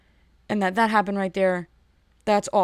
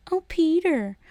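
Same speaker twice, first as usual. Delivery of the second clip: mock swoon; 8 semitones higher, slower than usual. Mocking a contestant's swoon.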